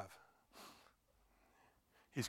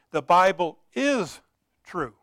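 slow, raised "is", then a dropped "true"; stress on IS; 4 semitones higher, 9 dB louder, slower than usual. Shown as a demonstration. Preacher insists nothing changed the Bible, stress on "is". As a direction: stretch "is" and pause after it. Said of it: "You know what the key word is?".

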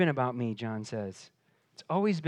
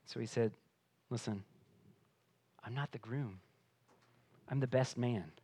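quiet John; 5 dB quieter than usual. Acted. John quietly telling his disciples to leave him, a soft resigned voicing. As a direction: let him go gently, almost to himself.

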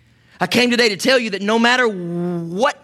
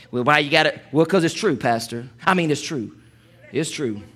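mock congregant; 7 semitones lower than usual. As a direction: answer the pastor as a sheepish churchgoer. Preacher voices a skeptical congregant.

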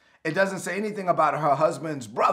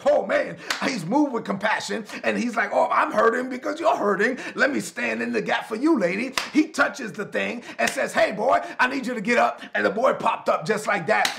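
higher, building preacher voice; rising sermon storytelling; 5 semitones higher than usual. Acted. Preacher telling the widow's story with mounting emotion.

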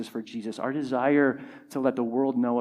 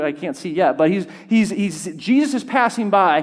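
ordinary talk

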